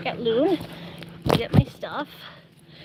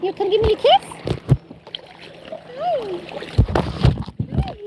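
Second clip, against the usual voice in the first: high sweet pet voice; 11 semitones higher than usual. Real, not acted. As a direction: coo at the dog for a kiss. Baby talk asking for kisses.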